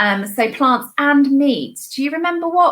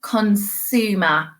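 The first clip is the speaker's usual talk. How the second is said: slow modelled word; 3 semitones lower, much slower than usual. Shown as a demonstration. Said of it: "we've got consumer".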